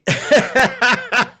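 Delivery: a high, bright burst of laughter; delighted laugh. Real, not acted. Delighted laugh at viewer comment.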